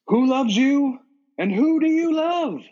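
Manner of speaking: high, loud game-show host catchphrase; showman's drawn-out, high-pitched shout; Eamon Killian. Acted. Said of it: "Richard Dawson as Eamon Killian".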